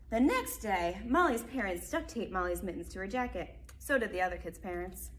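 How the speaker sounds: lively picture-book narration; reading to children. A narrator's reading. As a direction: read it out brightly to small kids. Reads picture book aloud.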